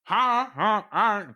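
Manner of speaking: high cackling laugh from offstage. Acted. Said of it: "From the other room, you hear".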